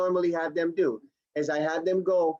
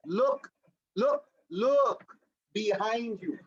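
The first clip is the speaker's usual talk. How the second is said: urging; 4 semitones higher, much slower than usual. Acted. Acts coaching a headset user.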